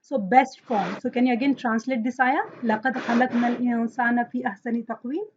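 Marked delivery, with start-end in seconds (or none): 2.6-5.3 very fast, soft recitation of the verse; Quran recitation. Shown as a demonstration. Teacher reciting the ayah for translation, quick soft recitation. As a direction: run through the ayah quickly and softly.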